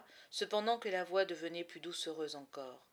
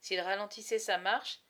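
ordinary delivery, nothing marked

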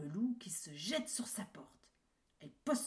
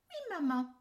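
put-on high, louder children answering; little kids' voice; 10 semitones higher, 7 dB louder, faster than usual. Kids answer mother.

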